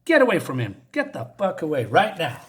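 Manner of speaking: mock shouted warning; retold shout. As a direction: call out sharply, then order them off. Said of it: "she shouts".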